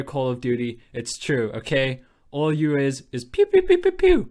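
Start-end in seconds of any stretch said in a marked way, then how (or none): 3.1-4.3 high, loud mock pew pew shots; playful gunfire sound effects mocking the game; gun noises. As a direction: make toy laser gun sounds. Imitating Call of Duty gunfire.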